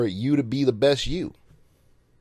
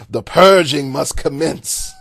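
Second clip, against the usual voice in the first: hammed-up louder doom proclamation; mock epic villain; 5 semitones higher, 8 dB louder than usual. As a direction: command the purge like a dark lord. Mock old-timers welcoming a battle.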